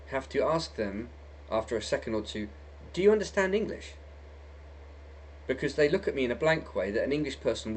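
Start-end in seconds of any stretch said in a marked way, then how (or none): 2.9-3.9 incredulous question. Retold or imitated. Asking strangers in a bar.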